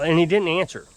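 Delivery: ordinary delivery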